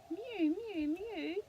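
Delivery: very high kitten mews; mewing kitten. Pretend. Reading to a child, kitten mews high. Kitten noise in bedtime book.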